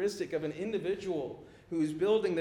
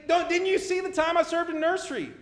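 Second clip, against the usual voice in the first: pleading churchgoer; 10 semitones higher than usual. Pretend. Churchgoer pleading before Jesus.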